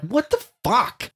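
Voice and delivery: high, slow muttered what the fuck; stunned disbelief. Retold or imitated. Dad retelling his shocked reaction under his breath. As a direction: drag it out in disbelief. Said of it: "I'm like".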